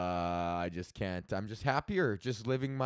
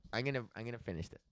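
ordinary talk

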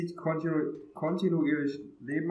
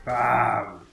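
loud exclamation; 3 semitones lower, 7 dB louder than usual. Real, not acted. Shooting an enemy in game.

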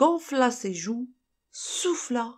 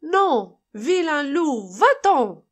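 high, loud scared shout; acted alarm, sending the wolf away; frightened cry; 5 semitones higher, 6 dB louder, slower than usual. A narrator's reading. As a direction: cry out as frightened little pigs. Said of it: "s'écrièrent les trois frères effrayés".

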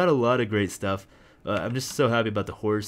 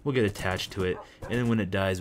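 ordinary delivery

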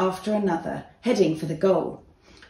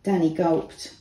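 usual speaking voice

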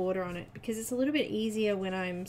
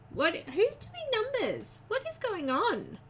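much higher, drawn-out disbelief; surprised; 14 semitones higher, 3 dB louder, slower than usual. Real, not acted. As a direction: squeak it out in amazed disbelief. Reacting to chat numbers.

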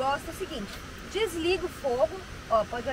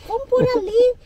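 quoting herself; 10 semitones higher, 8 dB louder than usual. Retold or imitated. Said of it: "eu acabei de falar pra ele".

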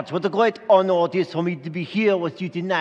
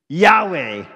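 louder, punchy Yahweh; punchline delivery; 6 dB louder than usual. Acted. Angel answering Zachariah, punchy punchline. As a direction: land the pun hard. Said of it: "what I said to him".

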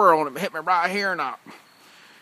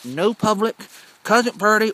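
ordinary talk

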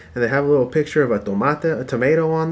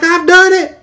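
mock triumphant yell; 10 dB louder, slower than usual. A man yells to his wife about his invention, triumphant. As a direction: crow it like a proud home cook. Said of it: "somebody at home yelling at his wife".

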